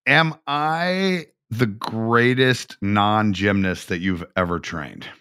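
ordinary delivery

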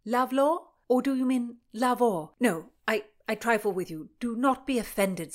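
jesting shout to a lady in armour; loud. Acted. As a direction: raise the voice to carry through metal. Lad shouting through metal helmets.

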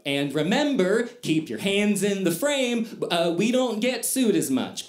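parroting the Disney training line; mock trainer reminder. Disney trainer reminding new costume workers, mock chipper. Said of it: "They would say".

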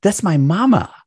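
high, lifted voice, bursting with pride; excited pride. Real, not acted. Son raving about his actress mother, high and lifted. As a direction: gush about her like a proud son.

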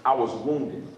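low, soft, confessing prayer to God; a mild, hushed prayer of surrender. Pretend. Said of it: "Take it to God".

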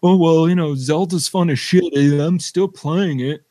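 loud, high, smug justifying voice; defensive gamer voice. Retold or imitated. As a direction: brag loudly to justify the buy. Voicing friend defending his purchase.

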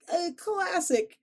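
very high, slow Chicago classic; Chicago accent. Real, not acted. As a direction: draw out classic Chicago style. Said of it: "my Chicago accent came out".

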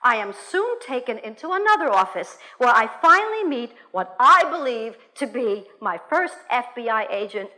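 ordinary delivery, nothing marked

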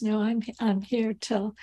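ordinary talk, nothing marked

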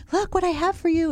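high-pitched sing-to-the-pet voice; talking to a dog. Retold or imitated. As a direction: imitate someone offering a dog a treat. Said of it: "he was all excited".

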